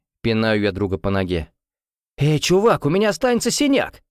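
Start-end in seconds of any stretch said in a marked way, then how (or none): 2.2-4.0 high, fast outraged protest; indignant. A narrator's reading. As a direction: squeal in indignation. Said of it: "возмущается Лиам".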